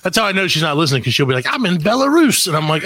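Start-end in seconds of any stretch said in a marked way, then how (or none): 1.5-2.5 high mock-sister voice; lightly mimicked sister, pitched up; sister's voice. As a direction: toss it off high like the globe-trotting sister.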